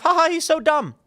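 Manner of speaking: high, sneering mock laughter; mocking snooty laugh. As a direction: lampoon the smug elites.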